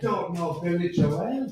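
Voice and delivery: slow put-on Irish accent, rising at the end; playful amateur Irish accent. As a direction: draw it out with an Irish lilt. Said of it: "that good at an Irish accent".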